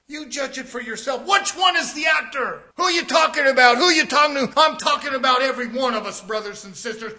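loud, rising pulpit shout; preaching hard. Acted. Preacher accusing the church of acting, loud and rising.

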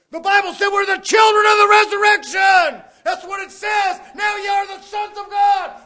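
loud, high shouted preaching; full-force preaching. Acted. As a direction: ring it out to the whole church. Preacher shouting his climax.

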